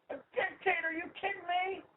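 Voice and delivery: can't believe what he's hearing; incredulous scoff. Real, not acted. Scoffing at Obama as dictator.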